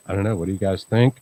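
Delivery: normal talking voice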